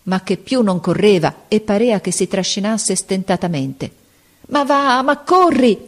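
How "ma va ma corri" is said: high, exasperated cry through gritted teeth; frantic urging. A narrator's reading. Captain desperate at the slowing boy, frantic urging. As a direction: push the runner on, voice pitched right up. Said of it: "stringendo i denti e i pugni".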